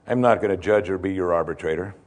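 low-pitched, firm acted refusal; quoted answer in a low, measured voice; calm refusal. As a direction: turn the man down, dropped low. Preacher voices Jesus refusing man.

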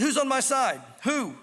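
calling up. Acted. Jehu looking up at the palace window, calling out. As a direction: call up demanding an answer.

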